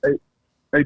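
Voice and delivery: normal talking voice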